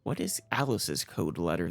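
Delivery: ordinary talk, nothing marked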